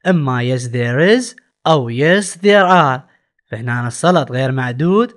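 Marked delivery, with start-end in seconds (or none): 0.1-3.0 slow answer options. Shown as a demonstration. Teacher reads answer options.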